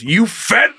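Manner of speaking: angry. Retold or imitated. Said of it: "The man angrily says".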